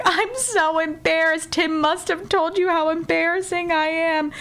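hammed-up embarrassment; imitating embarrassed apologizer. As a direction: apologize as a mortified, awkward guy. Retold apology of embarrassed friend.

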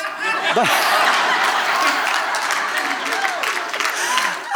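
long high breathy laugh; airy high laughter; real laugh. Real, not acted. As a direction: laugh it out at yourself. Laughing at himself.

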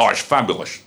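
low, put-on Dutch accent. Performer replying to the accent question, in a Dutch accent. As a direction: toss it off in a mock Dutch accent. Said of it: "the accent".

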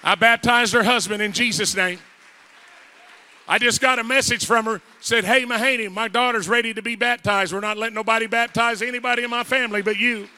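fired-up pulpit shouting; full-force preaching. Real, not acted. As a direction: proclaim it forcefully to the whole church.

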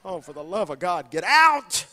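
mock exasperation rising to a loud get out; exasperated shout. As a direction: shoo him out the door, half-joking. Wife exasperated, shoving him out.

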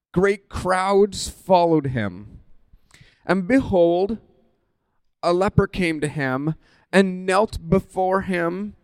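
slow, solemn reading; scripture reading. Acted. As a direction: read the text reverently. Reads scripture slowly.

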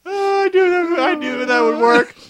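gleeful high voice, loud; high excited gloat. Acted. High excited villain quote.

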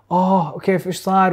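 suddenly lost, asking what happened; mock panic. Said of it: "تلاقي".

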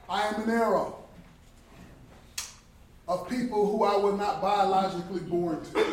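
loud, passionate sermon delivery; preaching at full force. Acted. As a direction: proclaim it to the whole hall.

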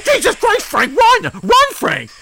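frantic high cry, pushing him to flee; panicked yell. Acted. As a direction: yell at Frank to run. Urging friend to flee danger.

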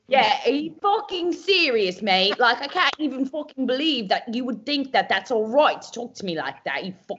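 high, ranting Essex impression; mock Essex accent. Imitating reality show cast in a row, mock Essex accent. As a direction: rant in a hammed-up Essex accent. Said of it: "people who talk like this".

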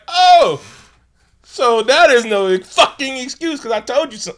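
Oh jumps high and loud, then yelled fast and hard; sudden furious shout. Retold or imitated. Host acting out Tommy snapping at the dinner guests, roaring. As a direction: snap from calm to a full roar. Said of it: "he went zero to one hundred real quick".

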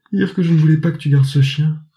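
mother holding her found daughter, exclaiming; mother's exclamation. Acted. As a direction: cry it out hugging her daughter. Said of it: "la maman de Charlotte s'écria".

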